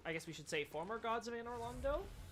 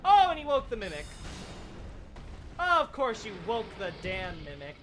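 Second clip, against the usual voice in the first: exasperated; 3 semitones higher, 9 dB louder than usual. Real, not acted. Exasperated at enemy waking.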